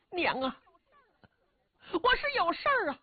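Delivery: put-on high boyish voice, urgent; a young son pressing his reason; pleading boy. Son pressing his mother, high and urgent.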